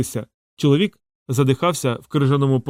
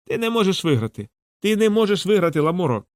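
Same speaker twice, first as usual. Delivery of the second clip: taunting; 6 semitones higher than usual. A narrator's reading. Grey king taunting while beating.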